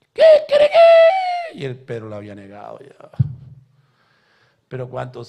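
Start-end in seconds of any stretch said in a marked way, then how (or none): 0.1-1.6 very high, slow, drawn-out falsetto squeal; mock rooster call; comic squeal. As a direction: stretch it like a comic rooster cry. Comic voice in Peter story.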